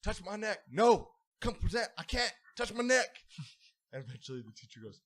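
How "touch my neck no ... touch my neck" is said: back-and-forth argument. Retold or imitated. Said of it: "went back and forth".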